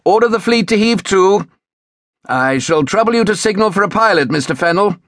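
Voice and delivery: high, carrying captain's command; called order. A narrator's reading. Captain ordering a signal for a pilot, calling out. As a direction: call the orders across a ship's deck. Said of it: "the captain called".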